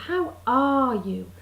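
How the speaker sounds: slow "How ARE you", middle word stretched; stress on ARE. Retold or imitated. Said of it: "So you're stuck with".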